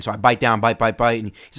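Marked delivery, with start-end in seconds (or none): none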